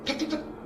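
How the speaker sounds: sharp high "ठक, ठक, ठक" knock sounds; imitated knocking. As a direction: tap out the knocks with your voice. (ऊँची आवाज़ में खटखटाहट की नकल)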